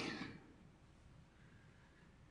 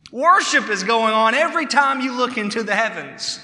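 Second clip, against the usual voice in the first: usual speaking voice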